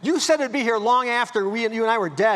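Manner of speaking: high, loud, panicked pleading; hyperventilating protest. Retold or imitated. Son-in-law reminding father-in-law of his promise, panicked and loud. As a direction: voice climbing in frantic disbelief. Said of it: "I hyperventilated".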